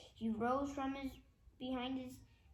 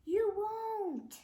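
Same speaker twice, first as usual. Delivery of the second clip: laughing; 10 semitones higher, 5 dB louder than usual. Acted. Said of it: "laughed Professor Poopy Pants".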